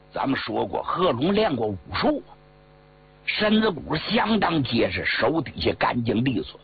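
ordinary delivery, nothing marked

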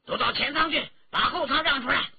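high, loud soldier's bark; shouted order. A narrator's reading. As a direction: shout them out of the cabin. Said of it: "一名清兵喝道".

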